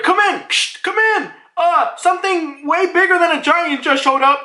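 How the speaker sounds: high, urgent mock radio report; high-pitched alarm call; panicked radio caller. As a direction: imitate a frantic soldier on the radio, static and all. Panicked radio call with static noise.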